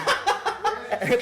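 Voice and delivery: high giggle; high laugh. Real, not acted. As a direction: break into a giggle. Laughing mid-quote.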